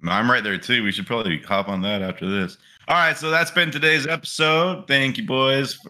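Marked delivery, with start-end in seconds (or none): 2.9-5.8 bright, higher sign-off voice; podcast outro. Real, not acted. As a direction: wrap it up like a host.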